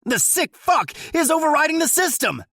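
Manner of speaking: very high, loud panicked yell; frantic shout. A narrator's reading. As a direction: scream it in disbelief. Deputy still shouting.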